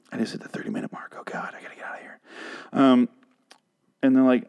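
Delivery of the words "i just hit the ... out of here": fast, whispered aside; whispering. Real, not acted. Whispering to self about hitting the time limit, fast. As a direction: hurry it under your breath.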